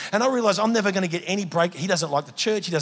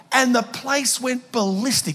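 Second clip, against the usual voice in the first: ordinary talk, nothing marked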